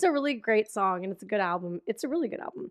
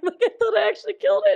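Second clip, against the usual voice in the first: excited protest; 9 semitones higher, 5 dB louder, much faster than usual. Retold or imitated. Retold protest.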